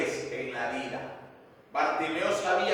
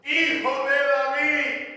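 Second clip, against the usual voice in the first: higher-pitched imitated cry to Jesus; clamor imitado; 7 semitones higher than usual. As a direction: call out as the blind man pleading from afar. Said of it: "clamaba y decía". (clamor imitado, en tono agudo)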